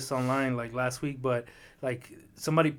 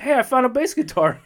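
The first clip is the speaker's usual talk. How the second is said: higher, brighter, pleased with himself; mock excited; 8 semitones higher, 5 dB louder than usual. Retelling a cartoon scene, a kid excitedly showing his find. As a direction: announce it like a proud kid. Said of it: "Then he goes like".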